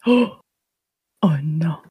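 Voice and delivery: whispered startled no. Real, not acted. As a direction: breathe it out under the breath. Shocked denial after gasp.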